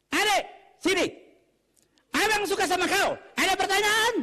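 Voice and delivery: high, shouted Batak courting voice; Batak man flirting.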